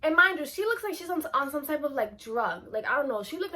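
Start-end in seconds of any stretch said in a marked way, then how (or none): none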